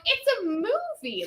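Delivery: high, slow retold explanation; incredulous explaining. Telling the man what Star Wars is, incredulous. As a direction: spell out the obvious in disbelief.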